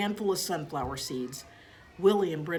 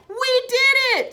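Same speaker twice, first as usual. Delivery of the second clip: much higher, louder, drawn-out cheer; excited cheer; 12 dB louder, slower than usual. A narrator's reading. Girl cheers when the hamster is caught, excited. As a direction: cheer with glee.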